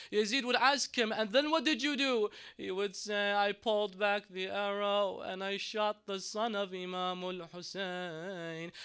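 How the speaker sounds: impassioned preaching. Acted. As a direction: preach it with rising intensity. Sermon preaching at full force.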